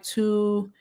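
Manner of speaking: ordinary delivery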